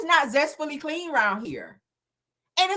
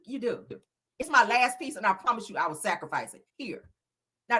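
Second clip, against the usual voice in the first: ordinary talk, nothing marked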